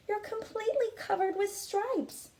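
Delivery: shocked mother. Acted. Mother staring at her striped daughter, high alarmed voice. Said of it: "she cried".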